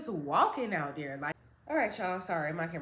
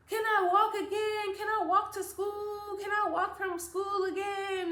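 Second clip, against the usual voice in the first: high pleading ask; a hopeful kid asking mom; 9 semitones higher than usual. Retold or imitated. Pleading with mom to walk home alone, high-pitched.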